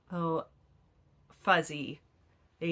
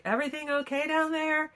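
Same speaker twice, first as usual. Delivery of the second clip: higher, called up toward downstairs; raised call, worried; husband calling out; 10 semitones higher than usual. Retold or imitated. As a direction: imitate husband calling down the stairs. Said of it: "And my husband yells".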